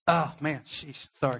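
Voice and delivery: real emotion catching him mid-story; holding back tears while telling it; getting emotional. Real, not acted. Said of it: "I'm gonna get emotional about it".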